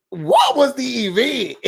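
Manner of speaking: high, slow incredulous question; baffled outcry. Real, not acted. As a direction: ask it like it makes no sense. Baffled reaction to Bria's event.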